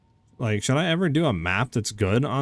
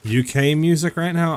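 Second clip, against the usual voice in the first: ordinary delivery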